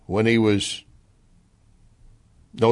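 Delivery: ordinary talk, nothing marked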